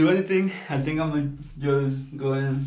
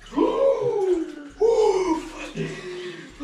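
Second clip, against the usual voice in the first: sharp gasp. Real, not acted. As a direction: gasp as the icy water hits. Stepping into the ice bath.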